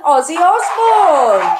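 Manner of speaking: high, loud, drawn-out announcement; excited reveal. Real, not acted. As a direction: shout the name out slowly like a big reveal.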